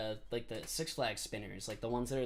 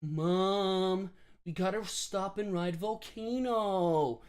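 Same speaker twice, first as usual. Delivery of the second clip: put-on high, slow pleading child voice, higher pitched; whiny kid begging; 7 semitones higher, 5 dB louder, much slower than usual. Kid begging mom on the drive home, whiny plea. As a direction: beg like a kid on the car ride. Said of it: "So every year I would be like".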